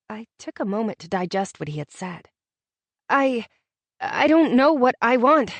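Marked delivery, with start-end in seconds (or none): 3.1-5.5 stuttering. A narrator's reading. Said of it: "I stuttered".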